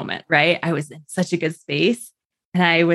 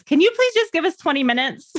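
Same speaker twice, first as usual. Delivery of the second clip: high, imploring request; pleading husband; 11 semitones higher than usual. Retold or imitated. Mother voices husband asking doctor for time, high plea. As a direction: plead for time in a raised pitch.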